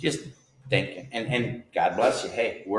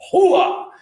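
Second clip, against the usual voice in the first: whoop; 12 dB louder than usual. Real, not acted. Big band excitement whoop.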